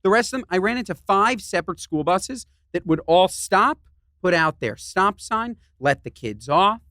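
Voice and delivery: normal talking voice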